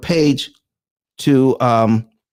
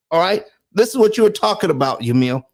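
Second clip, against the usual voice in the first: ordinary talk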